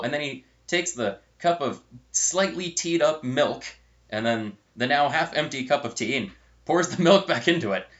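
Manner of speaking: ordinary delivery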